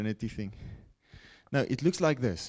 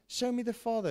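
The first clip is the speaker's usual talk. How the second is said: much higher, pleading request; Philip's plea; 12 semitones higher than usual. Acted. Philip asking Jesus to see the Father, high plea. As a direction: ask it like an eager disciple.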